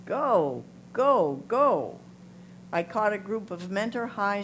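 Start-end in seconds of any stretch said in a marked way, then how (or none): 0.0-2.0 bright, louder cheer of go, go, go. Pretend. Reader voicing swimmers cheering a teammate, louder mock cheer. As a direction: call it out like a swimmer on deck urging a teammate.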